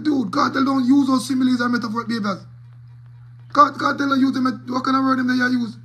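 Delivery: high, loud mock protest; mock outburst. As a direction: play it up like an exasperated rant. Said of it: "I'm like".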